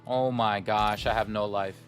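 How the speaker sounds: usual speaking voice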